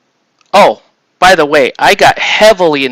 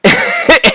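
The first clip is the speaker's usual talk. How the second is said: quick bursting chuckle, higher; short high laugh; 10 semitones higher, 4 dB louder than usual. Real, not acted. Laughing after recalled insult.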